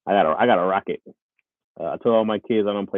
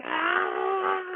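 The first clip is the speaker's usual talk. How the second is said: long, very high "ah" scream; mock running scream; 4 dB quieter than usual. Streamer acts out screaming while running, high mock scream. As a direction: hold a high comic scream without extra volume. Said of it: "once you start screaming and running".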